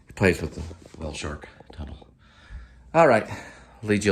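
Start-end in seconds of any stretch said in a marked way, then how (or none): 0.1-2.1 whisper. Real, not acted. Whispered aside.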